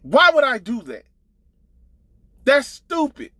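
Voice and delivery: ordinary talk, nothing marked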